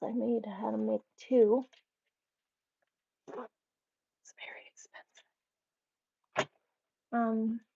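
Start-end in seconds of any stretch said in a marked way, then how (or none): 3.3-5.3 whispered aside. Real, not acted.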